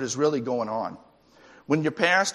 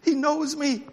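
high, delighted father's voice; proud father talking about his son, high-pitched; proud dad; 13 semitones higher than usual. Retold or imitated. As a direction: beam at the toddler who knows you. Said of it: "I'm saying".

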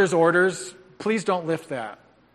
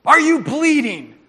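high, loud, slow questioning; drill-sergeant bark; 8 semitones higher, 7 dB louder than usual. Retold or imitated. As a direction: grill the kid like a tough coach. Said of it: "And then I did this".

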